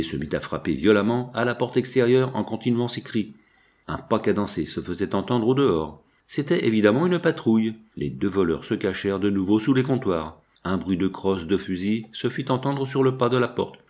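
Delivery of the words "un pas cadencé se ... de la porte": ordinary delivery, nothing marked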